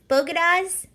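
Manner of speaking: "Bogotas?" mimicked with a rising, incredulous tune; mimicked mispronunciation. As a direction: echo her botched "Bogotas?" in disbelief. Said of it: "That was her saying Bogotas".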